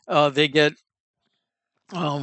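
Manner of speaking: ordinary talk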